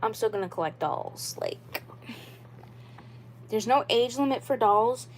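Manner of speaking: normal talking voice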